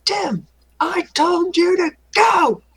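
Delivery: high, slow mock shout; scolding call. Player to kobold Tim who stopped moving, scolding. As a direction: draw out a high-pitched order.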